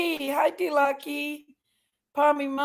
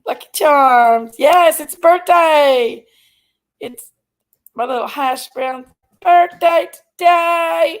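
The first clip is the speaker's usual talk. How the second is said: much louder, slow, proud birthday call; loud announcement; 2 semitones higher, 9 dB louder than usual. Real, not acted. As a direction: belt it out to the whole chat. Announcing birthday to live chat.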